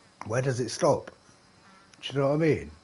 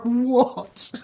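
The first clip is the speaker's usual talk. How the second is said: mock disbelief; 11 semitones higher, 4 dB louder than usual. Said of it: "I'm like".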